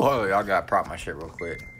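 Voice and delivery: normal talking voice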